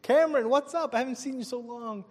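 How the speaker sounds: put-on high, surprised hail to an old friend; excited greeting. Speaker greeting long-lost coworker at Circuit City, excited greeting. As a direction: burst out high, then ease off in delight. Retold happy reunion greeting.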